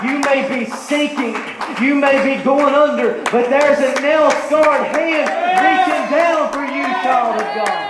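high, loud preacher's cry; fervent altar-call preaching; impassioned preaching. Acted. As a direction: lift them up with urgency. Preaching to the congregation.

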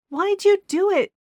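high, hurt accusation; wounded. A narrator's reading. Gina accuses Keith, wounded. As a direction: demand it, hurt and angry.

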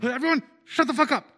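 loud, fast yell to hush; shouting. Acted. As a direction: shout over the crowd. Shouting over a crowd.

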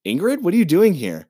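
startled bride asking what she is doing here; surprised bride. Retold or imitated. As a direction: act out the bride's surprise at seeing her. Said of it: "She goes".

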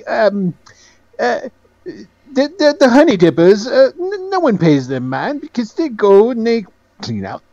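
high-pitched, hesitant explainer; Penholt. Acted. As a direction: explain delicately in a high, halting voice. Game master plays Penholt explaining.

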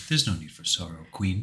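low, soft near-whisper; mock seductive murmur; lightly voiced Vamp. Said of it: "he's like".

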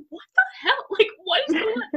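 high, laughing what-the-hell, rushed out; laughing disbelief. Retold or imitated. Retelling a fight with her cousin, laughing disbelief. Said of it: "I was like".

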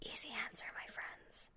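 soft conspiratorial whisper; whispered aside. Acted. To her viewers, a hushed whisper. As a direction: whisper the answer like a secret.

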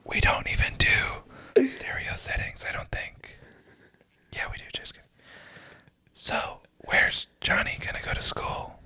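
soft close whisper; whispered asmr role play. Pretend. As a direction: whisper as if a child might hear. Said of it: "we're just gonna whisper".

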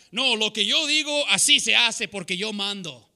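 mock bossy dad's tirade; domineering dad. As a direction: lay down the law in Spanish, shouting. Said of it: "one of those dads".

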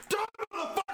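high panicked gamer yell; frantic yell. Retold or imitated. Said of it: "where you're like".

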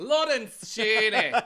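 slow, loud, high Scottish name; Scottish accent. Retold or imitated. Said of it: "Scottish now".

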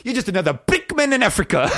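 a hammed-up, overdone South African accent in a big dramatic line; bad South African accent. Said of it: "he did a pretty bad job on that accent".

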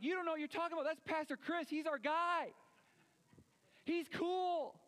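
high, indignant defending voice; defensive churchgoer. Retold or imitated. Churchgoer defending Pastor Chris to Jesus, high indignant protest. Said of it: "And you'd be like".

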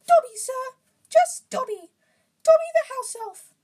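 very high, loud squeaky elf; Dobby. A narrator's reading. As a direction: squeak excitedly in a high falsetto. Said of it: "said the creature".

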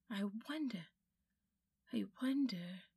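whispered wondering. A narrator's reading. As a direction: whisper it to himself, spellbound. Diggory musing over the bell.

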